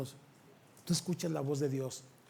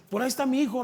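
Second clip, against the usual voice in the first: high, louder eager cry; excited mother; 9 semitones higher, 6 dB louder, much faster than usual. Retold or imitated. Mother at the kinder spotting her son, eager and high. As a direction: point out the child with sudden joy.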